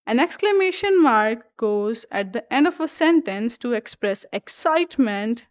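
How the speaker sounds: ordinary talk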